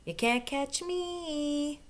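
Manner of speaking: high, very slow drawn-out taunt; a tiny monkey daring the crocodile; teasing monkey. Acted. Last monkey taunts crocodile, high slow sing-song.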